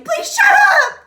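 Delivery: high, slow, screamed plea; screaming. Retold or imitated. Will Ferrell screaming at Derek, full yell. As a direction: scream it at the top of your lungs. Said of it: "Just screaming".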